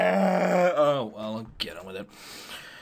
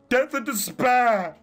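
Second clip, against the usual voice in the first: mock dramatic; 4 semitones higher, 4 dB louder, slower than usual. Mocking edgy teen.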